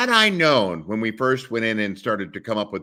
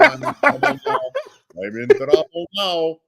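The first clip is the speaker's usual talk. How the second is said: mock panic; 10 semitones higher, 4 dB louder than usual. Mock panic over fiance.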